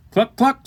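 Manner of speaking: two high, short hen clucks; chicken cluck. Acted. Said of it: "say the chickens".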